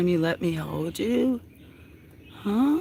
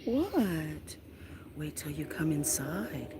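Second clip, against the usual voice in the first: ordinary delivery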